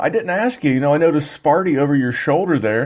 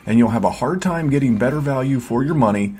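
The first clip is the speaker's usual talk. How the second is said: upbeat promo voice-over read; 4 semitones lower than usual. Acted. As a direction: sell the Patreon like a radio spot. Podcast ad read over music.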